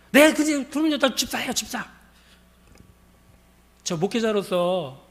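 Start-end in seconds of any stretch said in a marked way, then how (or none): 0.1-1.9 high, loud start falling away; acted indignant insistence; insistent retort. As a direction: insist on the title, mimicking her. Woman insists on her church title.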